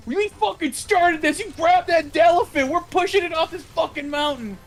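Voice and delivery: egging his partner on, half-shouted; mock pushy friend. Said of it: "And he's like".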